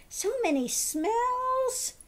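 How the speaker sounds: high slow happy dog speaking through its owner; excited dog voice. Retold or imitated. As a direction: gush like a delighted dog. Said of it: "They say".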